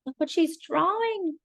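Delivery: ordinary delivery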